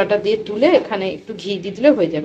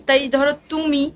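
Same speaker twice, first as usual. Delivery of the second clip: ordinary talk, nothing marked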